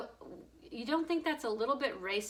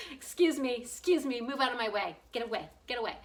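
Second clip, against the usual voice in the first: louder mock pushy shoving through; 3 semitones higher, 5 dB louder than usual. Shoving past people at Publix, mock pushy and loud. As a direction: push through the line, hammed up. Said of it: "I could see myself".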